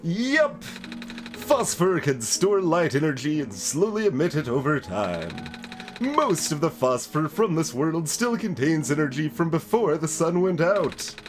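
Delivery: showy character voice, explaining eagerly; plant expert character. Acted. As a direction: hold forth like an animated plant expert. Voices plant-keeper character in game.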